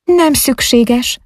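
slow, high-pitched refusal; cold, dismissive. A narrator's reading. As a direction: decline coolly, drawing the words out. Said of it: "szólt hidegen, elutasítón". (hidegen, elutasítón)

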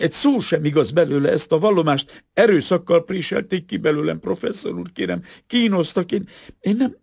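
high, loud desperate pleading; breaking into sobs. A narrator's reading. Assistant begs the professor to believe her, near sobbing. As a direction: plead frantically, faltering at the end. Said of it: "Kitört belőle újra a zokogás".

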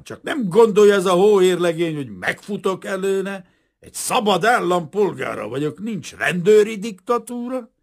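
high, loud indignant tirade; character ranting in outrage; rude outburst. A narrator's reading. Kazimír refusing to run from detective, angrily. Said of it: "mordult rá Gorombán".

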